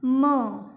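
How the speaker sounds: "म" said as muh, not em; teacher modelling the short sound "म"; phonic M sound. Shown as a demonstration. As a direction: sound out "म" alone, firmly. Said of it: "एम की phonic sound कौन सी है".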